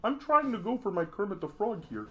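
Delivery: high-pitched cartoon frog voice; Kermit the Frog. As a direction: struggle through a high put-on puppet frog voice. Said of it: "I can't even do my Kermit voice".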